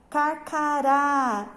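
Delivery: "carcará" slowly, with a long, open final á; teacher's slow model "carcará", last syllable stressed and open; open stressed vowel. Shown as a demonstration. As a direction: stretch the open á at the end of "carcará". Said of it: "A pronúncia deve ser aberta".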